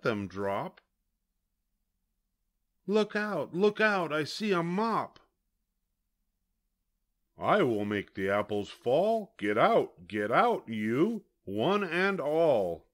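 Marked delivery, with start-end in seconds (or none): none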